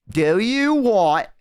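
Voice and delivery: sigh, then drag out every word; mock over-slow enunciation, as if to someone dim; exasperated slow repeat. Fed-up florist repeats to confused customer, mock exaggerated slowness. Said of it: "Like that".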